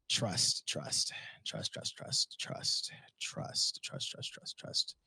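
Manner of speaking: soft repeated whisper. Pretend. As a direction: breathe the word out, no voice. Repeating trust to reassure.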